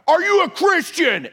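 high, hollered imitation question; exaggerated meathead yell; mock muscleman shout. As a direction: demand it like a hyped-up lifter. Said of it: "No one has ever talked to me like that".

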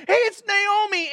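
high, bright call of recognition; surprised townsfolk. Retold or imitated. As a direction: townspeople calling out in amazement. Townspeople greeting returning Naomi.

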